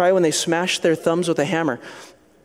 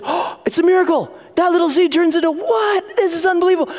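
put-on amazed child; 12 semitones higher than usual.

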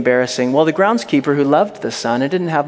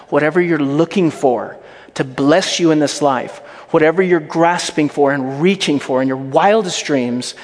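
impassioned preaching; 3 semitones higher, 3 dB louder than usual. Real, not acted. Preacher urging the congregation toward Jesus, impassioned preaching. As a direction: preach it with rising force.

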